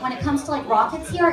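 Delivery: ordinary delivery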